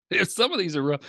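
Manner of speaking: ordinary talk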